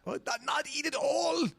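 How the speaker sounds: high, put-on yell; mock outraged shout. Co-host joking about the all-you-can-eat buffet, mock shout. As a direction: holler it in comic protest.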